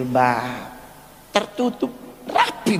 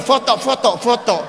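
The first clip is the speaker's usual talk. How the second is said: eager call for photos; 7 semitones higher, faster than usual. Retold or imitated. To his manager, excited calling out for photos. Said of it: "langsung bilang sama manajer".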